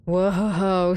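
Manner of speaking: ordinary talk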